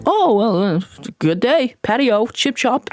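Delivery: hammed-up British accent, slowing after the opening; mock British. Imagining Trump brushing off minors, mock British. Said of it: "he talks like a British person".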